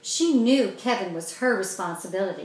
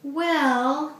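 hesitant; 6 semitones higher, 3 dB louder than usual. A narrator's reading. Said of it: "Mandy hesitated".

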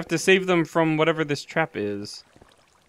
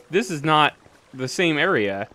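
usual speaking voice

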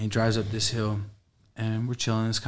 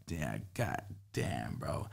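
drained relief; 7 semitones lower, 14 dB quieter, much slower than usual. Retold or imitated. Exhausted relief after the ordeal.

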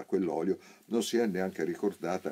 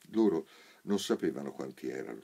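ordinary delivery, nothing marked

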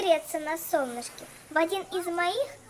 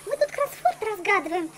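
usual speaking voice

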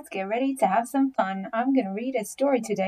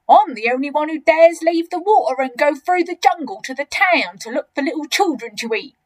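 loud, higher bragging; big croc showing off; enormous crocodile, boastful; 5 semitones higher, 7 dB louder than usual. Acted.

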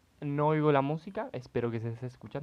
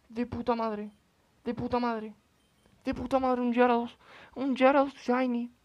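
much higher, thrilled exclamations; excited; 11 semitones higher, 5 dB louder than usual. Real, not acted. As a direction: gush with joy over the catch. Excited about shiny Gyarados. (emoción desbordada, muy aguda)

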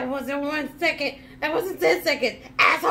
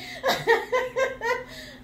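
hysterical laugh; 6 semitones higher than usual. Real, not acted. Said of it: "[name] laughing spell".

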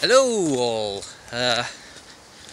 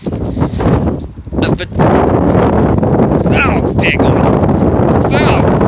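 wind on mic; 9 semitones higher, 7 dB louder, much slower than usual. Real, not acted. Sudden gust mid-sentence.

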